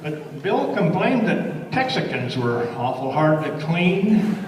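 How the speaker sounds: lively cowboy verse, lilting; cowboy poem recitation. Acted. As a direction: recite it like a campfire cowboy poet.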